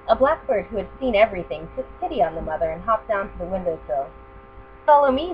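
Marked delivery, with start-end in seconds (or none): 4.9-5.3 high, louder bird call; chirping bird. Acted. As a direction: chirp it urgently. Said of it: "the bird chirped".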